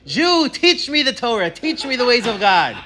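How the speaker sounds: high, rising declamation slowing into a drawn-out plea; prophetic proclamation. Retold or imitated. Teacher quotes Isaiah on the Messiah's coming, high impassioned proclamation. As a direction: cry it out as the nations begging to learn. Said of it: "the prophet Isaiah says that".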